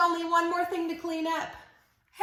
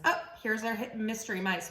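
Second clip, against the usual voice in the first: ordinary talk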